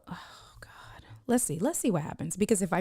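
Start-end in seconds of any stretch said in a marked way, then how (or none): 0.1-1.0 breathless whispered aside; murmured to self, barely voiced. Real, not acted. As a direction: hush it under your breath.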